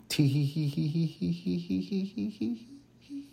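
high, spelled-out tee hee giggling; mock giggle. Mock giggle about podcast intent.

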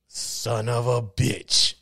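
slow, drawn-out acted cursing; deadpan curse. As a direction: let the curse out slowly, straight to camera. Acted deadpan hero to camera.

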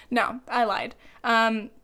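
ordinary delivery

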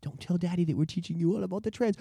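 low, soft teacher sharing a secret; conspiratorial hush. Acted. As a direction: hush a child, dropping toward a whisper. Acts teacher secretly hushing little Timmy.

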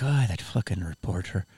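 a light attempt at an Irish accent, grumbling. Retelling an actor's elevator gripe about a reporter: lightly mock Irish accent. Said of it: "I'm trying to do his accent".

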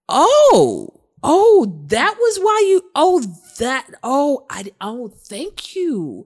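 high, loud oh-moments of dawning understanding; a string of startled, grateful ohs; sudden realization. Retold or imitated. Answering her husband with dawning surprise. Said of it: "And I'm like".

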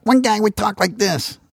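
cartoon Boston voice. Shown as a demonstration. Showing how one Boston guy sounded, high cartoon voice. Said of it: "growing up in Boston sounded like Top Cat".

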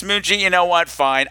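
usual speaking voice

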